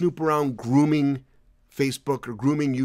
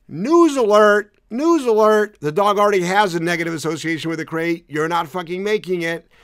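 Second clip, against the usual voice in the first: mock announcement shout; 5 semitones higher, 7 dB louder than usual. Answering crate critics with a loud sarcastic news alert. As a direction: blare it like a breaking headline. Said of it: "what my response is".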